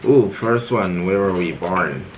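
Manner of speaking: normal talking voice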